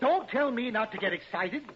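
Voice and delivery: angry retort. Acted. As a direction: throw the words back at him. Owner snaps back in alarm.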